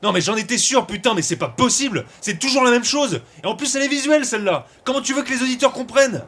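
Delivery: high-pitched, indignant complaint; exasperated outburst. Acted. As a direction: rant in exasperation, pitch climbing. Exasperated at another riddle.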